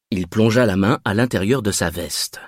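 plain reading voice

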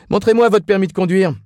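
loud, high, quick order; officious policeman. A narrator's reading. Policeman demands Ignatius's driving licence, officious.

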